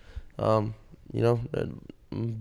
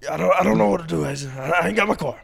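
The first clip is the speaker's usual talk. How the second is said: Brim impression; 5 semitones higher, faster than usual. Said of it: "You gotta do it like Brim".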